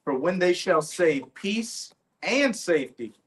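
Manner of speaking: forceful Bible declamation; scripture reading. Real, not acted. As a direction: proclaim the warning verse at full voice. Reads scripture aloud.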